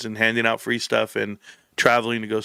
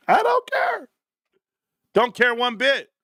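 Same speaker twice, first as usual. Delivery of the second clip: very high squeaky outburst; comic defiance; 11 semitones higher, slower than usual. Pretend. Streamer laughs off his own bullshit, high comic squeal.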